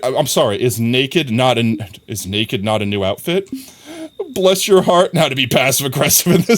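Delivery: ordinary talk, nothing marked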